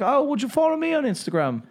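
high, playful Australian lilt; Australian accent. Retold or imitated. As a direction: mimic an Australian woman asking a favour. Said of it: "Australian accent".

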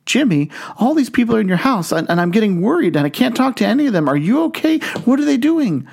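a caller's alarm spilling out fast; panicked worry. Acted. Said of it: "Telemarketer's getting a bit worried".

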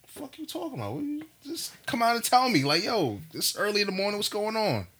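high-pitched incredulous complaint, retold; acted-out exasperated morning confusion; bewildered retort. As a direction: protest in a squeaky just-woken pitch. Acting out his bewildered morning reply.

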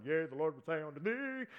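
loud, old-style mock prophet voice. Preacher mocking a churchy prophet voice, loud and pompous. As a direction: ham up a King James prophet. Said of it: "I'm not going".

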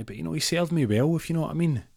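high, suggestive granny voice; cheeky old lady. Acted. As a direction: wink through it in a high old dear voice. Old lady innuendo in story.

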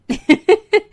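high bursting laugh; burst of laughter. Real, not acted. Host laughing at her own confession, high laugh. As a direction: laugh out loud at herself.

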